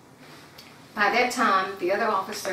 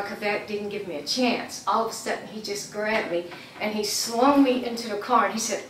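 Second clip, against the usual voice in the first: usual speaking voice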